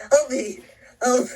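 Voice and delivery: loud, high cry for help; scream for help. Acted. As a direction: yell desperately for help. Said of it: "I don't know why I'm screaming".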